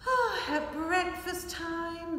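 ordinary delivery

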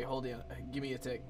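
ordinary talk

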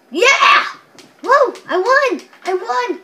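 high, loud victory whoops; triumphant cheering. Real, not acted. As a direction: celebrate a win at full voice. Cheering a win in the game.

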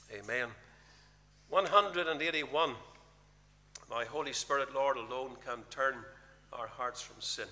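normal talking voice

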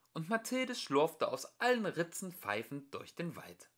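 plain reading voice

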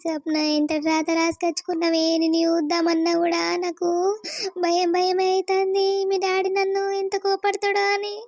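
high, tearful lament, slowing on the heaviest lines; weepy mother longing to see her child; grieving mother. Acted. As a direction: pour out a mother's ache for her absent daughter. Mother laments missing her daughter.

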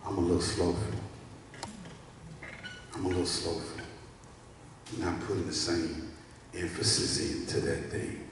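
dropped, subdued confession; sheepish admission. Acted. Preacher voicing a believer admitting neglect, subdued. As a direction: let the bragging fall away, softly. Acting a reluctant admission after boasting.

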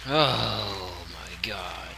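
very slow, low, drawn out; exasperated. Real, not acted. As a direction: groan it out, worn down.

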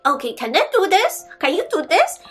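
high, swooping questions on "can I do this" and "Can you do this"; weirdest intonation. Retold or imitated. Speaker mocking how they sometimes talk, weird high intonation on "can I do this". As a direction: imitate your own odd tune, pitching "can I do this" and "Can you do this" way up. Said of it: "I have the weirdest intonation ever".

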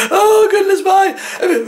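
high and laughing. Real, not acted. Butcher recovering from laughter, high breathless chuckling. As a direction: gasp it out between laughs.

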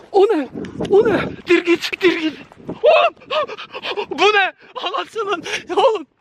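high panicked yelps; terrified shouting. Real, not acted. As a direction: shriek in real fright. Frightened by something chasing. (dehşetle bağırma)

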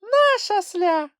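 very high, loud squeak; squeaky little claim; tiny creature's retort. A narrator's reading. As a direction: pipe back in a tiny voice. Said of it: "відказала Трясля".

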